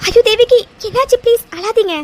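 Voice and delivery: high, loud alarmed cry of concern. Acted. Friend sees Devaki crying and rushes over, alarmed. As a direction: gasp out her name in shock.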